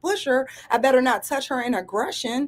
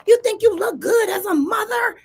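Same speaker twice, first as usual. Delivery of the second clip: high, raised-voice imitation of an angry daughter; imitating an angry comeback, pitched way up; angry retort; 9 semitones higher than usual. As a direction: fling it back as a heated challenge. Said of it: "turns back around all angry".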